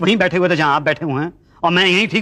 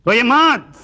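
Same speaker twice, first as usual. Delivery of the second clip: high, raised-voice plea; insistent consoling; 7 semitones higher, 4 dB louder, much slower than usual. Acted. Man urging a bereaved clerk not to cry, insistent. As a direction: press it higher and louder, drawing it out. Consoling again, much higher.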